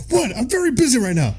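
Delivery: high-pitched put-on exasperation; mock irritation. Emperor taking another call, mock irritated. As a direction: imitate someone testily interrupted, voice jumping up.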